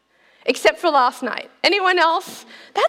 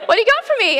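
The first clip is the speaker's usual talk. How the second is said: high, loud eager ask; mock eager wife; eager demand; 8 semitones higher, 4 dB louder, much faster than usual. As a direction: demand a refill brightly. Greeting husband with empty jug.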